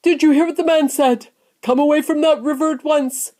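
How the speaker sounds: mother's loud, high scolding call. A narrator's reading. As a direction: order your child away, high and loud. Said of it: "called out Missus Gloop".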